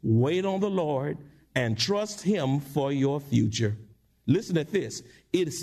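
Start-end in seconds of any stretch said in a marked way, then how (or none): none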